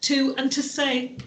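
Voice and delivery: ordinary delivery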